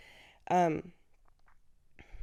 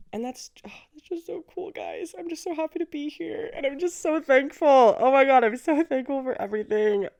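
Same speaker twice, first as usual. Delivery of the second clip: higher and louder, overflowing with real joy; gushing excitement; 8 semitones higher, 9 dB louder than usual. Real, not acted. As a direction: gush with gratitude to your viewers. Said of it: "I'm filled with love and joy today".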